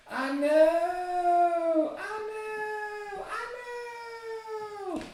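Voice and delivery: mock high voice, climbing and fading; high falsetto. Teasing reply about mustache.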